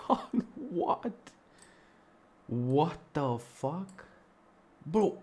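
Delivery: can't believe what just happened on screen; shocked disbelief. Real, not acted. Watch list suddenly vanishes.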